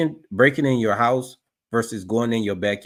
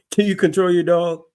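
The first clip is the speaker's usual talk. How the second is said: higher, louder mock cop voice; higher, louder mimicry of the officer; 8 semitones higher, 4 dB louder than usual. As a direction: parrot the officer's question with a sneer. Streamer mocks the officer's question.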